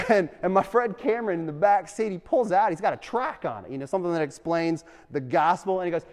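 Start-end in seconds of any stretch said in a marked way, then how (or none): none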